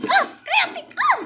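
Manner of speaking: high, furious shriek; angry scream. Acted. Pelea entre chicas. (grito furioso y agudo)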